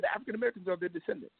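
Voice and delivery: rattled off fast like common knowledge; matter-of-fact quote. Retold or imitated. Retelling the Lebanese man's casual answer, fast and offhand. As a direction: toss it off quickly, just like that. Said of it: "He said".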